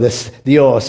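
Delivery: ordinary talk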